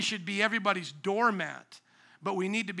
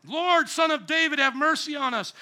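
raised, higher cry for mercy; louder shout; 6 semitones higher, 4 dB louder than usual. Acted. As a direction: call out over those silencing you. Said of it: "And he shouts louder".

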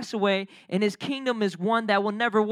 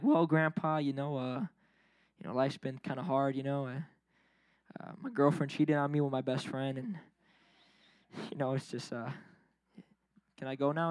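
softer, hesitant mock mumble at a grave; awkward mumble; 10 semitones lower, 10 dB quieter than usual. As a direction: mumble it awkwardly to a dead grandpa. Mumbling to grandpa at tombstone.

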